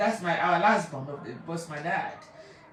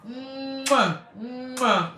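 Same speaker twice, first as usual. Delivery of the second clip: blown kisses; 4 semitones higher than usual. Real, not acted. Blowing kisses to her daddy.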